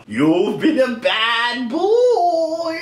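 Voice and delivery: very slow, drawn-out scolding; spooky ghost. Pretend. Ghost scolding Mickey, very slow and eerie. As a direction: stretch each word like a haunting ghost.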